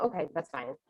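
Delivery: small, deflated acceptance; resigned agreement. Retold or imitated. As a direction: give in quietly, hiding annoyance. Gives in while secretly annoyed.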